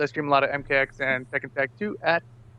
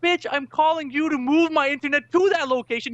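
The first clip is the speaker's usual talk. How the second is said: exasperated retort; 11 semitones higher than usual. Retold or imitated.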